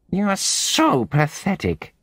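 high, nasal, slow sneer; high-pitched nasal voice. A narrator's reading. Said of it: "in her high-pitched nasal voice".

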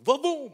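loud high explosion noise; big cartoon ka-boom; cartoon blast. Cartoon big mouth blowing mountains.

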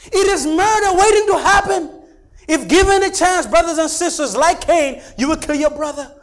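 high, loud, emphatic sermon cry; full-force preaching. Real, not acted. Pastor warning that hatred is embryonic murder, full-force preaching. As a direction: drive the warning home to the congregation.

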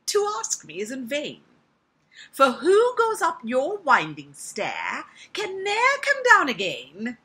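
high, loud little fly; wary female fly. Acted. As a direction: refuse warily in a high woman's voice. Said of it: "I want to make her a woman".

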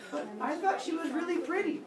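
high-pitched mock naive voice; naive objection. Voices a naive objection, then answers it.

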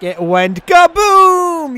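builds to a big shouted KABOOM, slow and high; dad-joke setup ending in a loud, stretched kaboom; kaboom joke punchline. Acted. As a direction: set up the joke, then blast out kaboom. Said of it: "Kaboom! Just like my bat says".

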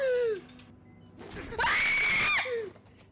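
shrieking laugh. Real, not acted. Laughs after shouting.

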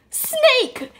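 high loud horrified snake; shrill disgust. Real, not acted. Vlogger rereading the label, horrified high blurt. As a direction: blurt it out grossed out.

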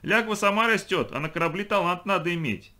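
high, loud argumentative cry; boy's shouted objection. A narrator's reading. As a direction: argue at the top of your voice. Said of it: "закричал Каська Мотнев". (выкрикивать возражение высоким голосом)

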